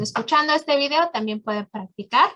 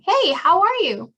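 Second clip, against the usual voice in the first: higher, lively hey; friendly greeting; 8 semitones higher, 4 dB louder than usual. Shown as a demonstration. Teacher showing how to greet someone in the room, bright. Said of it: "ask somebody in the room".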